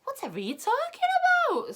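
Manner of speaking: feigned innocence. A narrator's reading. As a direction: play dumb about the mess. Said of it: "pretending not to know".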